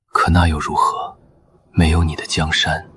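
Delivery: ordinary talk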